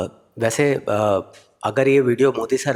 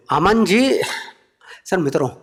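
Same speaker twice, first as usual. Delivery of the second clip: lofty PM speech tone; Modi impression; 6 semitones higher than usual. Modi addressing the comedian in a lofty speech tone. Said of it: "फिर मोदी जी बोले".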